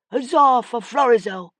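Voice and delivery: high, loud cheering cry; crowd cry. A narrator's reading. Revellers cheering the prince in the street, loud cry. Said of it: "he heard a cry".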